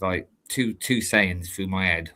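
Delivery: ordinary delivery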